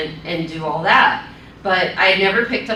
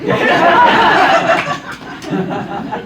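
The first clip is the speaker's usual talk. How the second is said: breathy high laugh, no voice; nervous laugh; 9 semitones higher, 5 dB louder than usual. Real, not acted. Laughs after sign-off.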